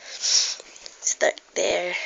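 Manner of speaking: soft unvoiced whisper. Real, not acted. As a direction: breathe it out in a whisper. Whispering to baby.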